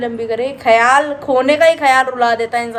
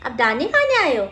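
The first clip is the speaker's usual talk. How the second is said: high, slow sing-song cooing to the bird; 9 semitones higher, slower than usual. Real, not acted. Talking to a bird.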